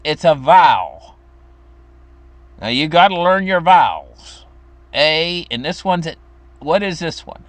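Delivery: firm teaching mom insisting he learn his vowels; stern mom at the little chair, pushing her son through his vowels; stern mother. Retold or imitated. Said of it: "And she'd say".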